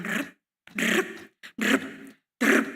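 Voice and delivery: short rolled bursts of galloping; trilled hoofbeat noise, spaced out; imitated hoofbeats. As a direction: imitate horses' hooves, one burst at a time. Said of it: "Lalu bunyi derap langkah kuda".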